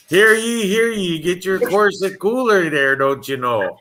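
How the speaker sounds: high-pitched Wisconsin town-crier call; upper Wisconsin accent. Acted. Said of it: "like, an upper Wisconsin person at a Renaissance Fair".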